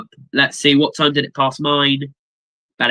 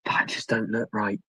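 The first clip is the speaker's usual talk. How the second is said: much softer put-on mutter; soft, odd-voiced mutter; quiet muttered repeat; 4 semitones lower, 10 dB quieter than usual. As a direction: mutter it under your breath.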